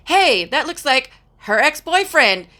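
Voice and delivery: daughter's exclamation. Retold or imitated. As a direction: imitate a daughter piping up with a joke. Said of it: "my daughter was like".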